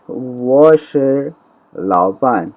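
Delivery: وہ شے drawn out slowly, then لاؤ پان falling; teacher reads the example sentence slowly for students; slow model Chinese. Shown as a demonstration. Stretched classroom demo. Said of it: "sentence ہے جی کہ".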